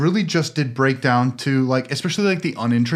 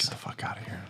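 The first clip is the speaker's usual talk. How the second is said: very soft, almost voiceless dismissal; mock disgusted mutter; 14 dB quieter, faster than usual. Host reacting to a co-host's pun, hushed mock disgust. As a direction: whisper a mock kick-out under your breath.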